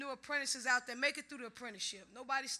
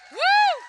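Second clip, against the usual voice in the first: loud, high-pitched "woo"; whoop; 21 dB louder than usual. Real, not acted. Speaker whooping to the crowd, loud high cheer. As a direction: let out a piercing cheer.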